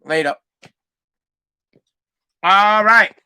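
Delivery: usual speaking voice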